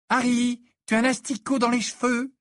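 put-on high, teasing girl's voice; amused. A narrator's reading. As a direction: point it out with high-pitched amusement. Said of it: "d'un ton amusé". (ton amusé, voix haut perchée)

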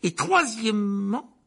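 loud, drawn-out, high announcement; stern uncle. Acted. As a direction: stretch it out imperiously. Uncle continues his list of rules.

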